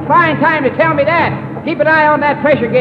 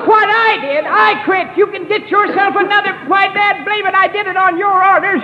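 higher, heated protest; indignant outburst; 7 semitones higher than usual. Acted. Engineer quitting on Gildersleeve, indignant shout. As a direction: fire back at the boss, hurt and hot.

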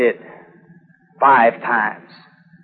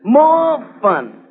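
bitter sarcasm; 7 semitones higher, 4 dB louder, slower than usual. Acted. Scarred veteran mocking the war.

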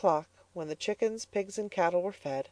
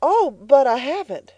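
higher, louder quick protest; hurried; 9 semitones higher, 8 dB louder than usual. Acted. Elnora hastily contradicting her mother, higher and louder. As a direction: rush to correct her. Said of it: "hurried Elnora".

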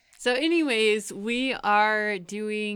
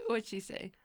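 ordinary delivery

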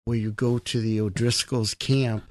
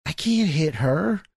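slow reluctance; 5 semitones higher, slower than usual. Retold or imitated. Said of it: "I'm like".

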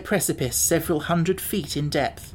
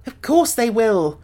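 put-on higher, louder, slow boy voice; Tip's boy voice; 7 semitones higher, 5 dB louder, slower than usual. A narrator's reading. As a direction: answer as the boy Tip. Tip answers.

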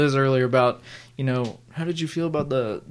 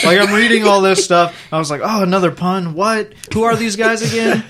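1 mock confusion; 6 semitones higher, 7 dB louder than usual. Mock confusion reading comic.

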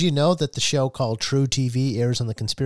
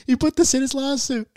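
laughing disbelief; 13 semitones higher than usual. Real, not acted. Incredulous about the lawsuit.

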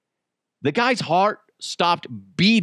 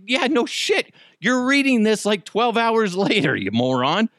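high, scornful mock reply; snide put-down, voice pitched up; sarcastic retort; 8 semitones higher than usual. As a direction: sneer at the latecomer. Mocking replies to people.

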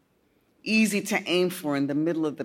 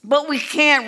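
put-on higher, louder boyish whine; whining; 8 semitones higher, 8 dB louder than usual. A narrator's reading. Boy protesting to his friend, whining. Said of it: "Robbie whined".

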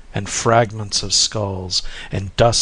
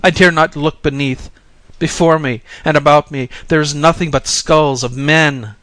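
raised, loud voice full of dread; fearful cry; 5 semitones higher, 5 dB louder than usual. A narrator's reading. Pilgrim telling the Bodhisattva he sees only skulls, fearful cry. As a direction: cry out as one too frightened to look down. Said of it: "cried the pilgrim, trembling and clinging".

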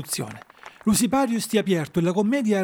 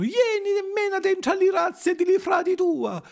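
high puppet voice, eager invitation; high-pitched affectionate puppet call; Arlecchino crying out; 13 semitones higher than usual. A narrator's reading. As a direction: squeal a welcome like an excited puppet. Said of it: "gridò Arlecchino".

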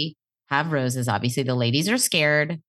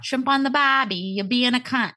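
higher-pitched, badly faked Aussie accent; bad Australian accent; 6 semitones higher than usual. Shown as a demonstration. Host trying the accent after admitting she can't, bad Australian accent. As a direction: have a failed go at Australian. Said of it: "I can't do an Australian accent".

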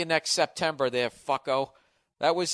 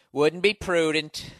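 slow, louder mock Bush voice; a terrible Bush impression, slow and pompous; George Bush impression; 3 semitones higher, 5 dB louder, slower than usual. Shown as a demonstration. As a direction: drag out the Bush catchphrase. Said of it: "That's a terrible impression".

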